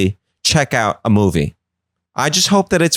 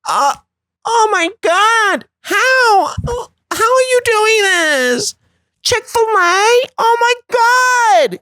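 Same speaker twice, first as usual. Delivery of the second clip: very high, slow, wailing outrage. Retold or imitated. Friends wailing at Michael over Chick-fil-A, high and hysterical. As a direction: melodramatic shrieking horror. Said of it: "all the gays are like".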